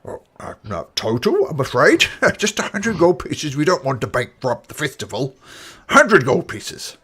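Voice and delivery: lightly voiced festival official. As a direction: congratulate them politely as the organiser. Official answers the player.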